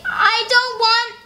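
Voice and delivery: high, slow, sulky refusal; stubborn little girl. Acted. Little Beatrice refuses her brother at the library, high slow whine. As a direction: whine it out like a reluctant kid. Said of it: "she started to say".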